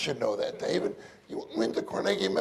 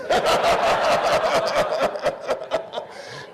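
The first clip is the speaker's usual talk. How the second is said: a higher, airy burst of laughter; hearty laugh; 8 semitones higher, 4 dB louder than usual. Real, not acted. Preacher amused by brother David's reply, laughing freely. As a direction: laugh out loud at the joke.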